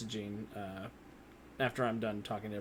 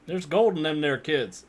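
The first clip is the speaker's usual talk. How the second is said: loud, slow country drawl; hammed-up cowboy catchphrase; country accent; 5 semitones higher, 12 dB louder, slower than usual. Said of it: "is gonna be my catchphrase".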